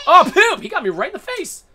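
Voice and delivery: high, loud yelp of dismay; startled outcry. Real, not acted. Gamer hit in the face in game, high outcry. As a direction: burst out high as you're hit.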